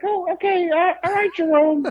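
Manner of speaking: very high, nervous placating voice; mock Howard. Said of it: "and then Howard was like".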